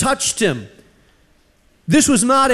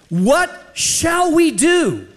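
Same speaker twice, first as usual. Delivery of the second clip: slow, higher-pitched emphatic question; pleading question; 8 semitones higher, much slower than usual. Retold or imitated. Crowd asking Peter and apostles, slow pleading. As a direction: draw out each word, pitch lifted.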